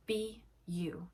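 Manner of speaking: ordinary delivery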